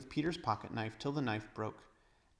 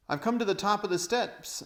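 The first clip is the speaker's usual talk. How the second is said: loud, high call down a stairway; boy calling from the dark steps; 10 semitones higher, 7 dB louder than usual. Acted. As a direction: call back to the others. Edmund calling from below.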